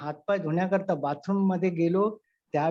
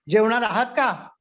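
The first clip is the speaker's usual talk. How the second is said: sharp question; 3 semitones higher, 6 dB louder than usual. Retold or imitated. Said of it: "बाणासारखा प्रश्न आला".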